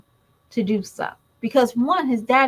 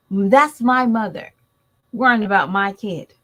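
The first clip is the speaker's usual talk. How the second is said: ordinary talk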